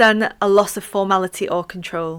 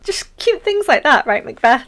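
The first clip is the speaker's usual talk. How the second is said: high, loud, sing-song teasing; mock-cutesy sarcasm; 6 semitones higher, 6 dB louder than usual. Real, not acted. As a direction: coo it with playful irony, pitched way up. Sarcastic teasing of murderous Macbeth.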